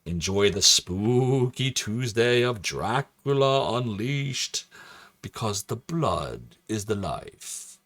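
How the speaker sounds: very slow, drawn-out vampire voice; Dracula. Pretend. Streamer presenting the special bonus stream, hammy vampire. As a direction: stretch the words like a spooky horror host.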